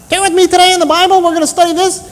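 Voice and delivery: high and fast, like a tape on fast-forward; sped-up chipmunk voice. Retold or imitated. Pastor mimicking a sped-up Bible tape, high chipmunk squeak. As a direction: squeak it out like a sped-up cassette preacher. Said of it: "he sounded like a chipmunk".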